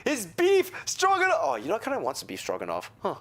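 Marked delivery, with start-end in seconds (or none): 0.0-1.4 delighted disbelief. Acted. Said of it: "Oh, he's be".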